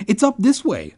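high-pitched call back. A narrator's reading. Boy running ahead answers friend, calling back. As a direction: call it back to someone behind you. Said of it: "I called back to him".

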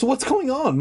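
high-pitched mom voice; calling mom. A narrator's reading. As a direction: call out as a puzzled mother. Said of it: "Mom called from".

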